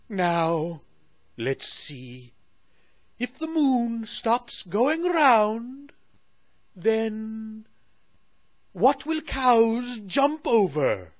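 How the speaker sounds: high, slow troubled royal voice; fretting Moon Queen. Acted. Queen fretting to Sally about the moon, high and slow. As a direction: worry it out loud like a queen. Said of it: "asked the Moon Queen".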